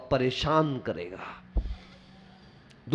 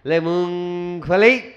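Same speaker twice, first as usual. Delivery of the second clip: drawn-out train hawker's cry; peanut seller's call; 2 semitones lower, slower than usual. Acted. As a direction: imitate a peanut vendor, stretching the word slowly. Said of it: "मूंगफली बेचने वाला आता है".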